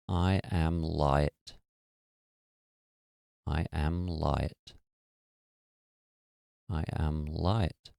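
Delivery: each word drawn out very slowly; slow mantra. Shown as a demonstration. Teacher modelling the mantra for the listener, slow chant. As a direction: let the mantra sink in, slow and even. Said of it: "I want you to just repeat the phrase".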